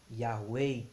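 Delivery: "Yahweh" loud, ending in a long closed "ei". Shown as a demonstration. Teacher demonstrating the Tsirei vowel, long closed-E "Yahweh". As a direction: sound out "Yahweh" as Yah-wêi. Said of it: "mais longo".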